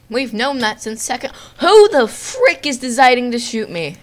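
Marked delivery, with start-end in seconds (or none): 1.6-4.0 high, loud demand; indignant shout. Real, not acted. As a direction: demand loudly who is shooting at you. Being shot in game.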